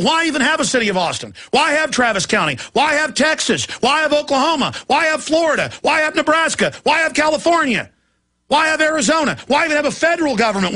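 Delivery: high, building indignant rhetorical questions; a host ranting with mounting outrage; rising rant. Real, not acted.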